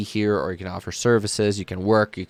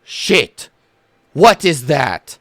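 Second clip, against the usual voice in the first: higher and louder, spooked cry at a giant spider; startled alarm; 6 semitones higher, 6 dB louder, much slower than usual. Acted. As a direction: recoil in shock at the spider. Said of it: "all of them have the same reaction".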